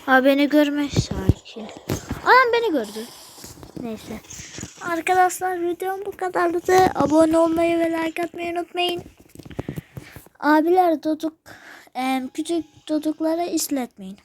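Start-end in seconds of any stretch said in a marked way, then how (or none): 2.2-3.1 much higher, louder panic; frightened high outburst; alarmed cry. Real, not acted. Spotted by monster. 4.8-14.2 higher bright sign-off voice; cheerful outro announcement; YouTuber outro. Acted. Video outro.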